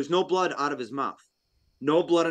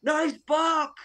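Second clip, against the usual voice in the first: put-on high, slow child's voice; little kid voice; 13 semitones higher, much slower than usual. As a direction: pipe up like a small kid. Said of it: "little kid in the backyard going".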